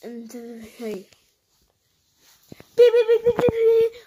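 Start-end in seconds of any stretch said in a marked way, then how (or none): 2.8-3.9 high, loud rapid beeps; beeping noise. Pretend. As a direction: imitate a beeper, high and insistent.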